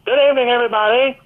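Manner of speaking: high, booming arena announcer voice; fast, high stadium PA call; John Condon announcer. Retold or imitated. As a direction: announce it like the Garden PA man. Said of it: "a fellow as a P A announcer by the name of John Condon".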